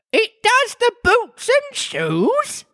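very high, slow solemn creature; squeaky but grave; solemn Griffin. A narrator's reading. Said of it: "the Griffin replied very solemnly".

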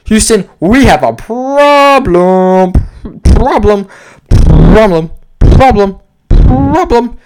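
hammed-up Apollo 13 quote with echoing repeats; mock echo effect on problem; movie line echo. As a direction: play it like a dramatic space-mission line, echoing problem.

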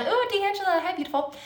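high, bright squeal of delight; excited greeting. Real, not acted. Greeting a live viewer.

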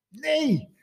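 sharp high cry of no; queen shrieking her denial. Acted. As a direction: yelp it out high. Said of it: "gilde de koningin".

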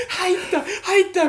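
high, loud triumphant yell; excited shout. Retold or imitated. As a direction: celebrate a lucky shot. Boys cheering over tennis balls.

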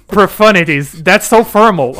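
loud, laughing mock of a fancy word; teasing exclaim. Real, not acted. Teasing his friend's word choice, loud laughing mock. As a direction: tease him for sounding so formal.

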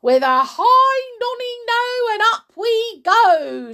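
high, loud, singsong whooping; exuberant shout. A narrator's reading. Grandma shouts as she rises, exuberant shout. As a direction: shout it like a joyous launch call. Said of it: "she shouted".

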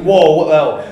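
startled what the hell, replayed for friends; startled yell. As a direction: jump back in fright, retold. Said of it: "He was like".

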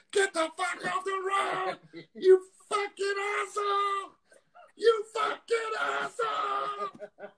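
high furious yelling, dragged out slow; screaming driver. Retold or imitated. Raging motorist yelling at a scooter rider, high furious shout. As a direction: scream it like an enraged driver stuck behind a scooter. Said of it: "And all I hear is".

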